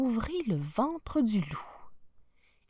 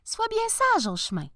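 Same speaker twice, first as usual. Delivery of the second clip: mother's voice; 13 semitones higher than usual. Acted. Mother warns her daughter.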